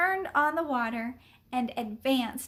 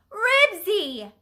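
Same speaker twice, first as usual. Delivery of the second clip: high, loud shouted call; 8 semitones higher, 8 dB louder than usual. A narrator's reading. Boy calls off his fighting dog, shouting. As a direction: shout the dog's name urgently. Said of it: "shouted Henry".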